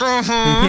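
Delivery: strained high uh-huh through pain. Retold or imitated. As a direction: squeak it out in pain. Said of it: "And I was like".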